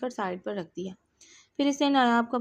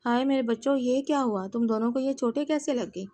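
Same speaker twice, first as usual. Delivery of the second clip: ordinary delivery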